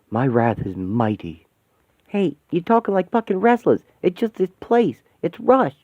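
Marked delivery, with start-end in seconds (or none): 2.1-5.7 alarmed protest. Acted. Warning friends, alarmed.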